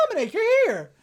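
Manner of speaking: high, excited hello; lightly hammed-up cheer; mock greeting. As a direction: greet him like a welcome guest, mockingly. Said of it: "They're not like".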